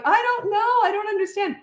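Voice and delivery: high, helpless "I don't know"; a young girl at a loss; flustered cashier. Retold or imitated.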